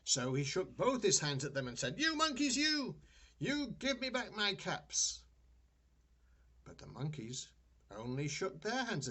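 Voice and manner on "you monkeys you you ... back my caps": much higher, louder, slow furious yell; angry peddler. A narrator's reading. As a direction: shake both fists and yell. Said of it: "This made the peddler angry".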